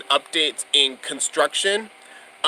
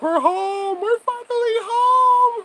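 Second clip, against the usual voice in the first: mock tearful cry; slower than usual. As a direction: cry out with joyful tears, overwhelmed. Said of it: "tears on our eyes".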